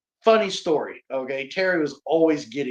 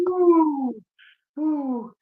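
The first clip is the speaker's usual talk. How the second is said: much higher whoop of disbelief; excited woo; 13 semitones higher than usual. Real, not acted. Reacting to the host's chop joke, excited woo. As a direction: let out a wincing woo.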